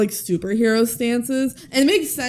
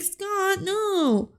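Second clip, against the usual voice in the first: whiny teacher; 9 semitones higher, 4 dB quieter than usual. Retold or imitated. Teacher protesting weakly.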